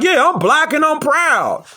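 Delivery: mocking proud quote. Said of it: "you be like".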